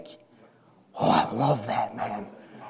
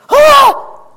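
scream; 15 dB louder than usual. Acted. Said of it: "wake some of you up with that little scream".

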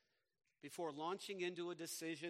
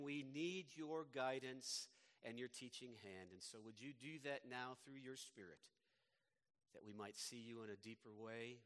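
prayer growing softer and lower toward the amen; public prayer; 4 semitones lower, 4 dB quieter than usual. Real, not acted. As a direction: pray for guidance, sinking to a hushed close. Leads congregation in prayer.

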